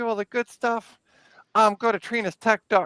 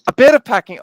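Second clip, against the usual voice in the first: loud echo; 3 semitones higher, 12 dB louder than usual. Real, not acted. Echoing a request with surprise.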